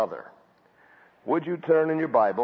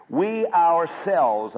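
"we ourselves" dragged out slowly and louder; stress on ourselves; 3 semitones higher, 5 dB louder, much slower than usual. Shown as a demonstration. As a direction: lean hard on "ourselves", stretching it. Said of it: "I'm emphasizing the word ourselves".

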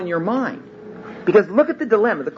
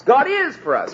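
is lifted high and stressed; 10 semitones higher than usual. Shown as a demonstration. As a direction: lean hard on is. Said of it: "putting the emphasis on four different words".

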